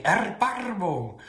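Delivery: high, drawn-out Baltic German with rolled R's; loud Baltic German lilt, falling at the end; Tante Lala. Retold or imitated. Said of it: "with her Baltic accent".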